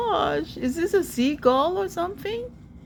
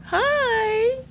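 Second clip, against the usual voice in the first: high, rising coo; sweet cooing; 9 semitones higher than usual. Real, not acted. Greeting the bird that came right here, cooing. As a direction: greet a little animal tenderly.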